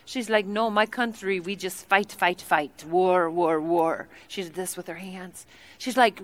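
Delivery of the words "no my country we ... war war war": low, soft, repetitive Congolese-accent imitation; mock Congolese accent. Tea lady describes her country, low mock Congolese accent. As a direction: imitate her Congolese accent, dropping lower on each repeat. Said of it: "her accent sounded nothing like this".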